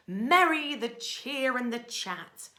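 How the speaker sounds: ordinary delivery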